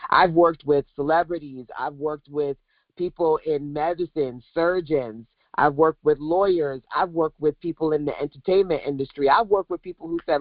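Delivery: ordinary talk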